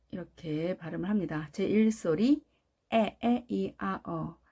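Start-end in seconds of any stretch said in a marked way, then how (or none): 2.9-4.4 에, 에, 이, 아, 어 as short, separate sounds; short, strong vowels. Shown as a demonstration. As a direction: list 에, 에, 이, 아, 어 crisply, falling away. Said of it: "특징은 짧고 강하게 발음한다". (에, 에, 이, 아, 어를 하나씩 짧게 끊어서)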